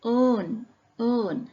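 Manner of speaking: "Earn" said twice, each alone with a long vowel; earn sound demo. Shown as a demonstration. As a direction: model "earn" for learners, falling at the end. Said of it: "what you hear".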